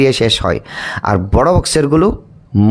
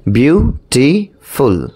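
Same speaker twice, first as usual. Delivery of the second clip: Beautiful split very slowly into বিউ, টি, ফুল; slow, segmented beautiful with clear gaps; syllable by syllable; much slower than usual. Shown as a demonstration. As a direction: break beautiful into three separate beats. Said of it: "three syllables".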